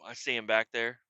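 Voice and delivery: ordinary talk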